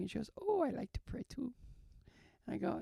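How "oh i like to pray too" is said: imitated nurse, pitched high; odd nurse. Odd nurse joins praying patient, imitated in high voice. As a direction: lift the voice into an odd nurse's curious lilt. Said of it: "she had a weird energy to her".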